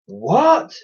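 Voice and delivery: very high shocked cry; exclaimed. A narrator's reading. Twins exclaiming in shock at the boy's wish. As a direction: gasp it out. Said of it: "exclaimed the Envies".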